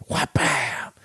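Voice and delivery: mouth-made impact noise; impact sound effect. Retold or imitated. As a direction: smack the sound out as the hit lands. Imitating suplex impact.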